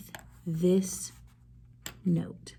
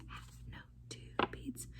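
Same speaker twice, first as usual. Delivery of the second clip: whispered; 17 dB quieter, slower than usual. Shown as a demonstration. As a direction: whisper "Half note, two beats". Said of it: "Say half note, two beats".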